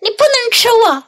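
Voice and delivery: high, quick defiant cry. A narrator's reading. Gingerbread man defies the old couple, quick high cry. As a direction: call out high and fast. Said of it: "他叫了起来".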